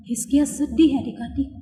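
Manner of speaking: usual speaking voice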